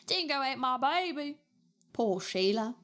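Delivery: broad, put-on Australian accent; mock Australian accent. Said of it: "I like your accent".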